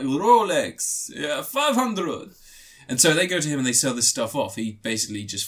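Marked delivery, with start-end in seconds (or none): 0.0-2.3 slow, higher mock Russian gangster; lightly put-on Russian accent; half-hearted Russian accent, higher and slow; vague Russian accent. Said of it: "ambiguous Russian accent".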